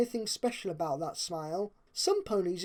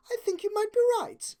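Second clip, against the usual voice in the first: much higher, refined lady voice; Rarity's voice; 14 semitones higher, 3 dB louder, faster than usual. A narrator's reading. Rarity agreeing with Fluttershy about the coming storm. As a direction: play the elegant unicorn, a touch put out.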